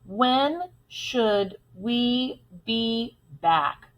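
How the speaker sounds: very slow, separated words; one word at a time, evenly. Shown as a demonstration. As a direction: space each word out to be counted. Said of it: "Let's count how many words".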